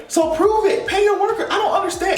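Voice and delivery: high mock challenge.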